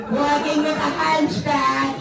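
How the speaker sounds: normal talking voice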